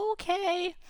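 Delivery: very high, small, sad okay; a dejected little rabbit. Acted. Rabbit agreeing sadly, high and small. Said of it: "had a sad look on his face and said".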